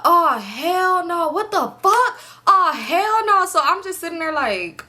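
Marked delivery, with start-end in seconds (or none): 0.0-3.4 high-pitched mock yelling; retold yelling. Telling how he found the window busted, mock yelling. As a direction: imitate his cussing outburst, high and drawn out. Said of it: "he yelling, sound like [name]".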